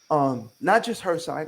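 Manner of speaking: normal talking voice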